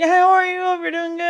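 high sugary greeting; overly sweet fake pleasantry. Mimicking fake sweet customer.

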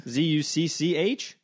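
letters spelled slowly, unsure; slow, unsure spelling. Real, not acted. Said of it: "Is it two C's?".

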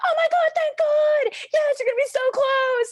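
very high, loud, slowed ecstatic squeal; thrilled parents. Retold or imitated. Parents hearing their daughter will live close, loud ecstatic squeal. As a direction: shriek with joy as overjoyed parents.

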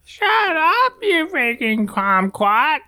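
put-on high, slow squeaky Yoda-like baby voice. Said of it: "That's, that's Yoda".

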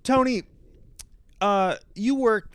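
high, loud, slow, with a mic pop; popped plosive. Shown as a demonstration. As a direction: blast the name into the mic. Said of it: "I see I threw one in there".

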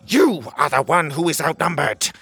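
mildly heated royal comeback; spitting anger. Acted. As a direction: throw the retort back angrily. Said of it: "the king spat".

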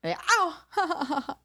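high sudden ow of pain; wincing from a paper cut. Real, not acted. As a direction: yelp as the cut stings. Cut still hurting.

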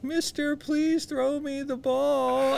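put-on high, slow pleading kid voice; mock child's plea, high and drawn out; kid begging. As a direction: beg like a little kid for the ball. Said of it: "you know, like".

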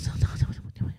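breathy whispered refusal; hushed disgusted no no no. Real, not acted.

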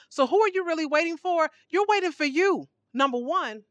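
ordinary talk, nothing marked

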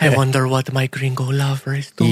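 high, far-off put-on lady voice; man doing a woman's voice. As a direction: camp it up as a lovesick woman. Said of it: "say it again, dude".

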